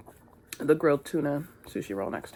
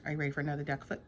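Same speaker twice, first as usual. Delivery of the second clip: normal talking voice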